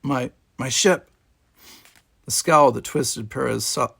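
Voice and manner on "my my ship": stammered. A narrator's reading.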